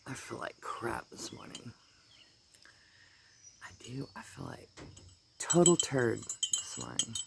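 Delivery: breathy whispered words, barely voiced; sick whisper. Real, not acted. Telling viewers she feels sick, in a worn-out whisper. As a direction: whisper it like you feel awful.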